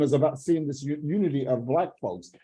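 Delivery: normal talking voice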